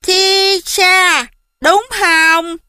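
put-on high child voice, checking a name; curious kid repeating, pitched high; little boy. A narrator's reading.

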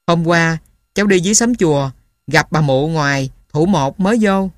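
ordinary delivery, nothing marked

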